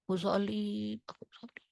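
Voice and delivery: soft, fading to an unvoiced whisper; whispered confession. Shown as a demonstration. Preacher shows how the soul prays without voice. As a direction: whisper it as the soul speaking inside. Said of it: "Ruhannya ngomong".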